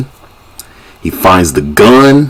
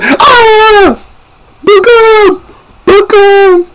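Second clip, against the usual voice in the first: very high-pitched panicked scream; mock yelling; 4 dB louder, much slower than usual. Scared by the body, high mock yelling. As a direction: scream out in exaggerated fright. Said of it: "What you keep yelling for?".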